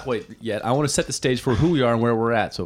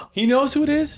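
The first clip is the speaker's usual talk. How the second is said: shocked gasp. Real, not acted. Shocked by gossip news.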